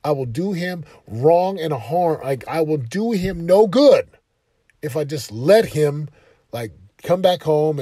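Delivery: firm resolve. Retold or imitated. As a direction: stand your ground as a father. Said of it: "But I'm like".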